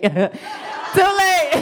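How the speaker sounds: high-pitched cry breaking into laughter; laughing shout. Retold or imitated. Comedian answering the paramedic's worry about broken insides, laughing shout. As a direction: shout it out, cracking up.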